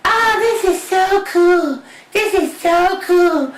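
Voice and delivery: high, slow, awed princess voice; a high, dreamy gush of delight; Cinderella. Acted. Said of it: "Cinderella's like".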